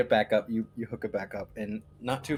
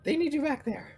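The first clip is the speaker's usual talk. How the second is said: very high-pitched gnome voice; tiny creature squeaking out a message; little gnomish creature; 11 semitones higher than usual. Acted. Gnome bringing word to the rider, very high put-on voice. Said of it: "little gnomish creature that you met".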